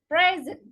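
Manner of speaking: the noun present, stressed PRES-ent; stress on first syllable. Shown as a demonstration. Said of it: "noun or adjective form is stressed on".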